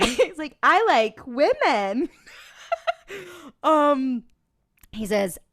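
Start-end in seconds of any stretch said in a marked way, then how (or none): 0.6-2.1 a hint of overcompensating man, slow and louder; lightly put-on, drawn-out insistence; mock straight-man act. Man straining to attract a woman, mock insistence.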